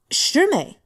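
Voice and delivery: sharp, high, loud cry of alarm; alarmed call. Acted. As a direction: shout her name to stop her. Senior calling out in alarm.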